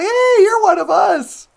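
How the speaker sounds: high, loud imitated cheer; mock welcome. Imitating the reindeer welcoming Rudolph, high and loud. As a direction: call it out in a mock-friendly cheer.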